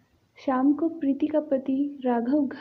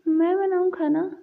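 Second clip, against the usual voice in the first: surprised; 5 semitones higher than usual. Acted. Preeti shocked at cooking order.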